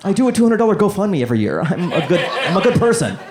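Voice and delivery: high, self-satisfied put-on voice; smug liberal. Comic mocking a liberal homeowner, high and smug. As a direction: play a smug homeowner patting himself on the back.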